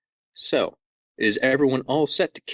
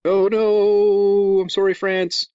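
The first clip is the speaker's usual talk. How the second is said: loud, higher wail of regret; mock apology; 7 semitones higher, 5 dB louder, much slower than usual. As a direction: overplay a dismayed apology to France. Mock apology to offended listeners.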